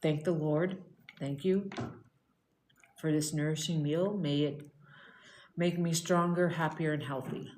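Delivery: soft, quiet mealtime prayer; a hushed little blessing over the food; grace before a meal. Real, not acted. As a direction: give thanks gently, head bowed.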